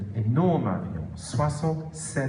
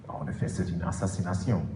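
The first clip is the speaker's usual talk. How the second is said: usual speaking voice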